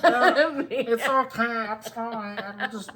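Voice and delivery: high, helpless laughter; laughing. Real, not acted. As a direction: laugh through the apology. Laughing after bumping spouse.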